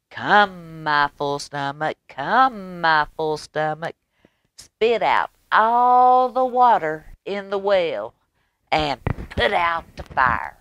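sing-song rooster commanding his stomach; rooster calling. Acted. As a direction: call it out like a proud little rooster. Rooster's magic call.

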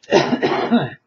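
sharp cough after a sip; coughing on coffee. Real, not acted. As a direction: choke a little on hot coffee. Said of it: "sip my coffee a little less strongly".

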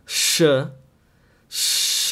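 "sh" hissed with the air at the front teeth. Shown as a demonstration. Teacher contrasts two letters, front-teeth sh. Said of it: "first one in the front teeth".

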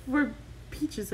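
ordinary talk

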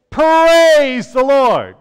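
slow, loud, high exclamation; joyful praise. Shown as a demonstration. Pastor showing children how to cheer praise the Lord. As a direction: ring it out with joy. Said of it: "Let's try that".

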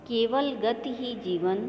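केवल still lifted but the line moves at an even pace; lighter recitation. Shown as a demonstration. Teacher repeating the line for pupils, lighter recitation. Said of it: "जिस प्रकार से मैं कविता का वाचन कर रही हूँ".

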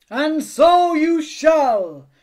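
slow, high, loud magical fairy voice; a grand godmother granting a wish, drawn out; hairy godmother. Acted. Godmother appearing to grant Spinderella's wish, slow and grand. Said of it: "came a voice".

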